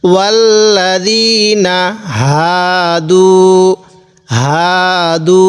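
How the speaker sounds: slow recitation with হাদু stretched and rising; recited demo. Shown as a demonstration. Teacher repeating the reading for students, slow recitation. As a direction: draw out হাদু with a rising end. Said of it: "এরকমভাবে পড়ব।".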